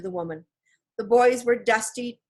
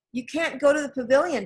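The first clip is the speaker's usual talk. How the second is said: cried; 6 semitones higher, 4 dB louder than usual. A narrator's reading. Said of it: "Jack cried".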